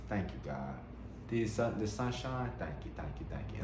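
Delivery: hushed devoted gratitude; quiet grateful prayer. Pretend. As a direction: murmur thanks like a purring cat. Cat's purr as grateful prayer.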